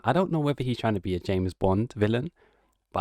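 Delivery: ordinary talk, nothing marked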